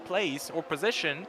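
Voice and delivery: ordinary talk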